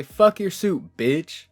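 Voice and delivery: high, loud parting shot; retold outburst, punched at the start; defiant insult. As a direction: toss an angry insult after him. Parting insult thrown at manager.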